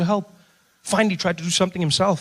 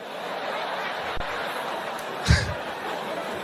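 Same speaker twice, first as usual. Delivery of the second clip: breathy laugh; 9 dB quieter than usual. Real, not acted. Loud laugh.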